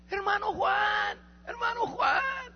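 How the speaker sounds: high, slow call from outside the door. Retold or imitated. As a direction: draw the name out, calling across the yard. Old woman calls him before dawn.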